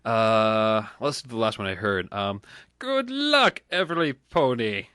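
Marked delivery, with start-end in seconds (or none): 2.8-4.9 high squeaky attempt at the line; badly done impression. Granny streamer badly imitating the pony line. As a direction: squeak the first word up high, then sag. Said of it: "That was horrible".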